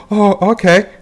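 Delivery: high-pitched "oh, okay" of giving in; mock acceptance. Believer acting out his response to Jesus' claim, mock acceptance. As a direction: act out conceding, starting high.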